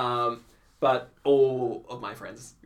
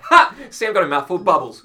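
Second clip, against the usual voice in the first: triumphant shout, much higher and louder; gleeful gloat; 5 semitones higher, 11 dB louder than usual. Retold or imitated. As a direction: crow with glee at the prank. Gloating at the bubble prank.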